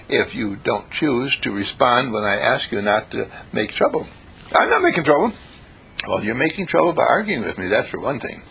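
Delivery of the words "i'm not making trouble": higher, protesting retort; mock protest. Imitating a listener denying any trouble, higher. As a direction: snap back like a defensive heckler.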